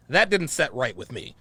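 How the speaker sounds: normal talking voice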